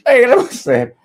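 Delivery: high, loud exaggerated Spanish quién es; mock Spanish TV voice. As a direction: ham up ¿Quién es? like a Telemundo host.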